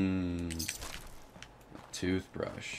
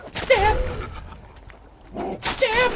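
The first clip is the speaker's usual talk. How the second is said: squeaky high battle cry; high-pitched stab; 4 dB louder than usual. Acted. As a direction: shriek it way up high. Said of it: "I can still do the high-pitched ones".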